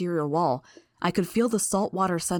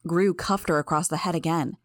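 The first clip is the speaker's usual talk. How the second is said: plain reading voice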